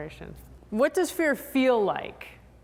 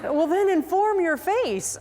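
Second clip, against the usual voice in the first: retorting instructor; 10 semitones higher, 4 dB louder, slower than usual. Retold or imitated. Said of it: "And I'm like".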